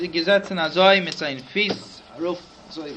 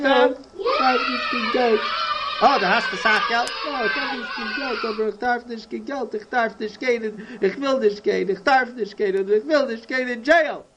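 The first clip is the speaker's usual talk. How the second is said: high, wailing run of protests; acted high-pitched lament; distraught pleading; 3 semitones higher than usual. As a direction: plead in a panic, repeating yourself. Acted distraught man pleading about jail.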